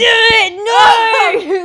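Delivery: real high, loud outburst; exasperated shout. Real, not acted. Player protests friend's wrong Shrek guess, high exasperated shout. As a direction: yell in playful exasperation.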